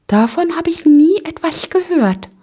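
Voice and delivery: very high, squeaky bafflement; high-pitched little disclaimer; little Ping-Pong, confused. A narrator's reading. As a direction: pipe it in a tiny puzzled voice.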